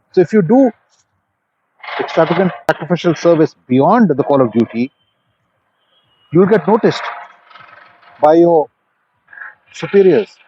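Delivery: uplifting closing appeal; motivational exhortation. Acted. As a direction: press the lesson home like a coach rallying a room.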